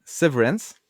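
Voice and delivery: « Severance » dit avec l'accent anglais; accent anglais. Shown as a demonstration. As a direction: prononcer « Severance » à l'anglaise, un peu plus haut. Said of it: "avec l'accent anglais". ([name] donne la prononciation anglaise de « Severance »)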